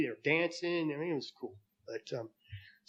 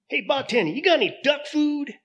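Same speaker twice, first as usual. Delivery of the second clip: high, louder silly duck voice; 12 semitones higher, 5 dB louder than usual. Acted. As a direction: squeak it out like a cartoon duck. Duck asks bartender again.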